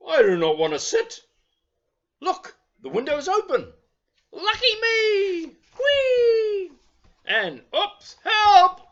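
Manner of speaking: put-on storyteller voices Lucky for children, high and eager; eager little bear. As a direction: play the bouncy toy bear itching to go out. Lucky jumps out window.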